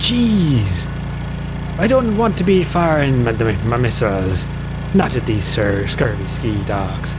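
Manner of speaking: mock pirate protest, dragging out the words; pirate talk. Pirate talking back to the armed car, mock pirate. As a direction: grumble like a West Country pirate. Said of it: "talking like a pirate".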